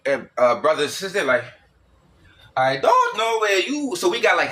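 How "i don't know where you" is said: high, slow mock African accent with swooping pitch. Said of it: "high ups and down pitches".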